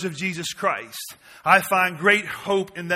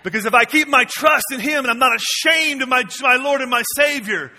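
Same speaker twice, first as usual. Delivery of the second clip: loud, high fiery sermon cry; full-force preaching; 4 semitones higher, 5 dB louder than usual. Acted. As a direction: preach it out to the back row.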